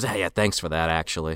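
low, soft, put-on mumble; mock sheepish aside. Kano thanking Goro on the side, quiet mock gratitude. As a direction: drop it into a discreet aside. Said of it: "he, like, says".

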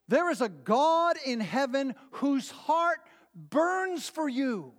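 slow, high-pitched fervent preaching. Acted. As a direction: preach it with rising fervor. Preaching at climax.

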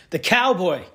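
normal talking voice

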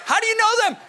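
high-pitched drilling question; dad quizzing sons. Retold or imitated. Father asking his kids every night, insistent. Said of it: "I would say to my kids every night".

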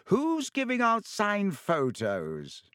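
high, slow, grand voice; showy Lockhart. A narrator's reading. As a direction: make it a performance. Lockhart booming cheerily.

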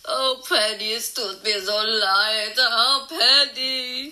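slow, drawn-out, tearful; sorrowful lament. Acted. Friend laments over Penny, slow and tearful. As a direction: grieve over her with a sob.

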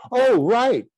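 a sudden high "oh, right" of realisation; surprised. Retold or imitated. Actor realising what the director meant, surprised. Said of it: "So I was so surprised".

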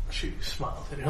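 usual speaking voice

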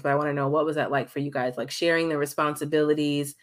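ordinary delivery

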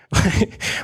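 brief, breathy self-amused laugh; short chuckle. Real, not acted. Preacher admitting tears at a car commercial, short chuckle.

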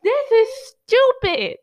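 slow, loud, high exasperation; loud, slow, exasperated outburst. Retold or imitated. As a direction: drag it out in disbelief. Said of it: "I'm just like".

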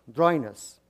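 ordinary talk, nothing marked

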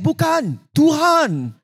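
high, ringing acted reply; calling out. Jesus answering the frightened disciples, calling out. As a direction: call it across the water, high-pitched.

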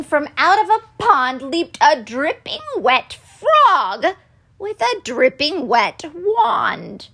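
ordinary delivery, nothing marked

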